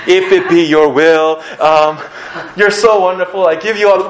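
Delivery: high, slow, loud pious droning; mock long-winded prayer. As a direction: parody someone padding out a formal prayer. Parodies a long flowery prayer.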